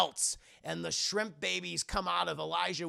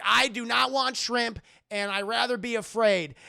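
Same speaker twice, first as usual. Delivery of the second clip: higher, louder mock refusal; defiant refusal; 6 semitones higher, 8 dB louder than usual. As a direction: refuse her flat out, raising the voice. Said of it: "And he's like".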